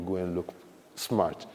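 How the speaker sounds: ordinary talk, nothing marked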